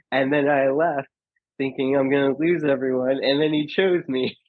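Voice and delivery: normal talking voice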